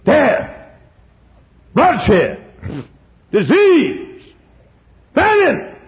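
high, loud single-word blows; proclaimed litany. Acted. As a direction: strike each word like a bell of doom. Litany of judgments.

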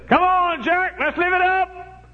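high, loud, slow party call; rowdy soldier buddy. Retold or imitated. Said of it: "they'd say".

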